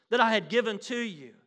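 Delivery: preached warning at full force; loud warning. Acted. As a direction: thunder it out as God's threat. God's warning voiced in sermon.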